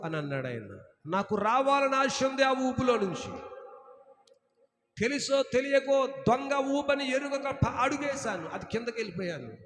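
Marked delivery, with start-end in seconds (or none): 1.1-9.5 full-force preaching. Acted. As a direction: proclaim it from the pulpit. Preacher voices the sinking believer. (గట్టిగా బోధించే స్వరం)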